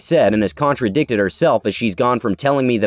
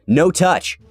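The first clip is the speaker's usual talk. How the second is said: broken English; 6 semitones higher than usual. Retold or imitated. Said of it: "says in his broken English".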